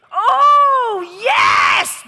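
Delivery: big high delighted shout; excited shout. Real, not acted. A preacher cheering a sharp line from the crowd, loud and high. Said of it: "excited shout at crowd".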